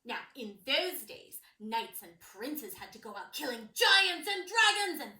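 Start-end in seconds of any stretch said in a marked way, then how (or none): none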